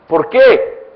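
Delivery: loud, rising preacher's cry; preaching at full force. Acted. Preacher to congregation, loud and fervent. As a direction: drive the point home to the congregation.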